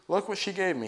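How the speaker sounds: bursting with news for Grandma; excited cry. A narrator's reading. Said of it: "he cried".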